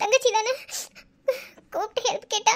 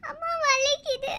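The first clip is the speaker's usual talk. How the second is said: soft pained moan through the words; 6 dB quieter, slower than usual.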